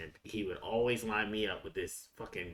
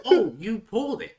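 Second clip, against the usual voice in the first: coach quote; 10 semitones higher, 11 dB louder than usual. Retold or imitated. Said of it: "he was like".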